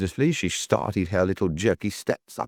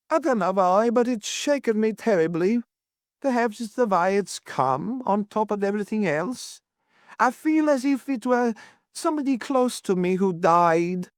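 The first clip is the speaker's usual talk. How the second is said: high-pitched, upset landlady; distressed Mrs Worth, shaken; 11 semitones higher than usual. A narrator's reading. Landlady shaken by her lodger's death, high lament.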